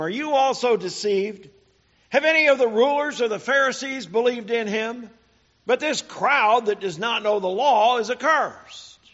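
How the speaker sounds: preacher voicing scornful, angry Pharisees. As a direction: rebuke them with contempt. Pharisees scolding officers, read aloud.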